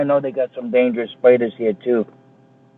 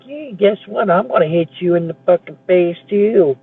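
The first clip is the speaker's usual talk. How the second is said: mock taunt; 7 semitones higher than usual. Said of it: "everybody else can be like".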